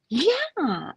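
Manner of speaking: high, drawn-out bubbly imitation; real bubbly. Neighbor saying yes to the fish, real bubbly. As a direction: bubble over with eager acceptance. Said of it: "real bubbly".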